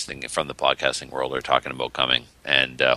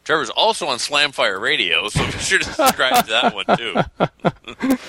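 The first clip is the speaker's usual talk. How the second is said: high, louder mocking read; mock review read; 12 semitones higher, 4 dB louder, slower than usual. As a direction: squeak out the review. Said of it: "and then it says".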